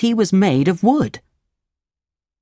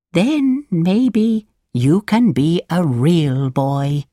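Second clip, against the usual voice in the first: put-on slow, drawn-out old woman voice; earnest old woman advising a child, deliberate; old woman, warning; 4 semitones higher, slower than usual. A narrator's reading. Old woman warns Pinocchio about the sea, slow warning voice.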